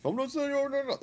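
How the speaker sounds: very high-pitched, panicky imitation; mock hysterical refusal. Man mimics employee refusing a sale, mock hysterics. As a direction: squeal it in mockery of a flustered clerk.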